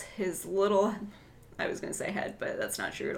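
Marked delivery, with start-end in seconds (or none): 1.6-3.2 breathy whisper, barely voiced; whispered joke about her husband; whispered aside. Real, not acted. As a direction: let it slip out as a hushed aside.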